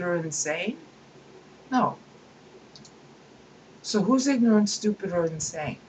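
usual speaking voice

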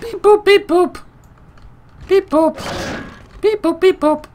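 high, loud robot beep-boop; squeaky button-press noises; imitated electronic beeps. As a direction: chirp like a little machine. Imitates keycard machine noises.

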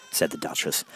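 ordinary delivery

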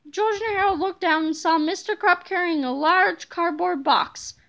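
ordinary delivery, nothing marked

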